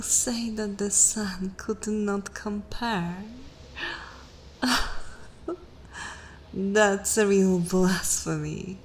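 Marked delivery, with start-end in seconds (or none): 4.6-5.5 short airy chuckle; a brief, voiceless laugh of disbelief; breathy laugh. Acted. Vampire scoffs at human's blasphemy, short breathy laugh.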